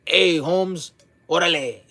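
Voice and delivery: hammed-up "órale". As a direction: imitate strangers' put-on Chicano slang. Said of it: "feel comfortable to be like".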